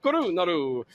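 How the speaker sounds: Korunaru again, mock Italian lilt; bad Italian accent. Shown as a demonstration. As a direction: play up Korunaru like a cartoon Italian. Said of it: "not an Italian you".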